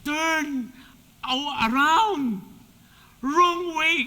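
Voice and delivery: loud, high shouted warning; shouting warning. Retold or imitated. Teammates shouting at the runner going the wrong way. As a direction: yell at him to turn back. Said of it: "Sumasigaw na yung mga".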